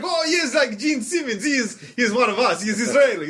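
high, excited mock-Israeli accent; Israeli salesman. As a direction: gush proudly as an Israeli salesman. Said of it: "he is not native to this country".

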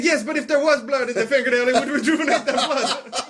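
high mock-Israeli accent, amused; hammed-up Israeli sales pitch; Israeli salesman. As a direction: insist as an eager Israeli salesman. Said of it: "he is not native to this country".

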